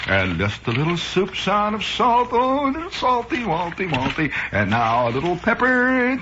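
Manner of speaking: high, singsong Westchester-jaw gourmet; hammed-up Harvard fop cooing over his cooking; Westchester lockjaw, Harvard accent. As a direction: trill and coo in an affected Westchester accent. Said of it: "He's got that swinging Westchester jaw".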